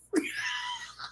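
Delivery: very high, soft squeal of laughter; high-pitched giggly squeal. Real, not acted. Laughs at own confusion getting dressed.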